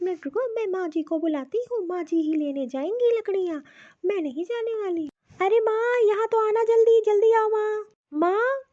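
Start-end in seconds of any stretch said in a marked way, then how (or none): 5.4-8.6 impatient daughter yelling for her mother; calling out loud. Acted. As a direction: shout for mother to come quickly. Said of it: "इतना क्यों गला फाड़ फाड़ के सुबह सुबह चिल्ला रही हो".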